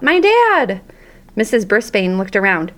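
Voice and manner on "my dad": high, sudden schoolboy shout. A narrator's reading. Boy answers the teacher's question, shouting out high. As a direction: blurt it out across the classroom. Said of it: "A voice shouted out".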